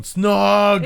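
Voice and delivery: high, loud mock crowd shout; drawn-out playful yell; re-enacted yell. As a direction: holler it like a fan egging them on. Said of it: "we were all yelling".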